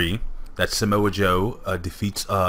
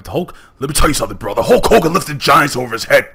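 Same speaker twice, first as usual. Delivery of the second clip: Hulk Hogan impression; 5 semitones higher, 6 dB louder than usual. Imitating Hulk Hogan catchphrase.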